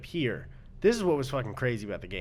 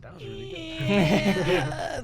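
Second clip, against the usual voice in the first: Minecraft zombie sound; 5 semitones higher, much slower than usual. Pretend. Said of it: "it's my Minecraft zombie sound".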